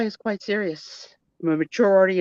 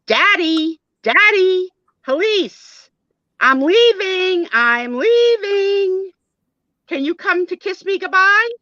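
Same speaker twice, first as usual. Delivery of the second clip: high, loud, drawn-out call through the house; yelling for Daddy; 12 semitones higher, 5 dB louder than usual. Acted. Daughter leaving home calls Daddy, high sing-song yell. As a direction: holler for Daddy, stretching the last words. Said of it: "and she yells".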